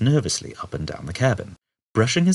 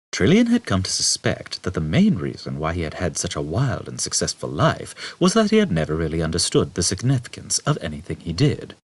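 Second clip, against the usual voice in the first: ordinary delivery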